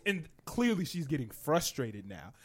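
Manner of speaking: usual speaking voice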